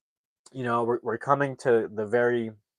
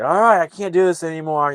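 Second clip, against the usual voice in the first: mad; 7 semitones higher, 8 dB louder than usual. Retold or imitated. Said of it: "He's, like, mad".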